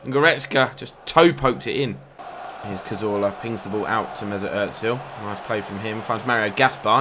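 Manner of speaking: usual speaking voice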